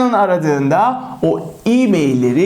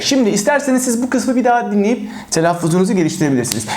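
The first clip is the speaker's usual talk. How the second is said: ordinary delivery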